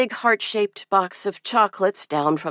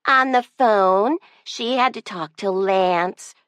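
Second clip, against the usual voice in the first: slow, sly little-sister tattle; tattling; 4 semitones higher than usual. A narrator's reading. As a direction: drag it out to get her sister in trouble. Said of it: "spotting an opportunity ... in trouble".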